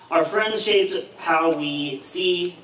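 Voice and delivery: ordinary delivery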